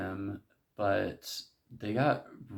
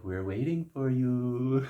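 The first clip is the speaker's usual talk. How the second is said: slow, higher imitated Japanese accent; 4 semitones higher, 3 dB louder, slower than usual. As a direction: put on a Japanese accent, drawn out. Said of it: "in, like, a Japanese accent".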